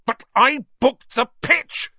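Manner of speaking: high, loud, slow fury; spitting rage. A narrator's reading. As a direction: spit the words out in rage. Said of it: "spitting with rage".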